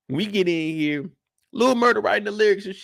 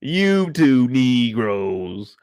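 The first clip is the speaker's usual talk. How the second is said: slow scolding; 8 semitones lower, 3 dB louder, much slower than usual. Retold or imitated. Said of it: "I'm like".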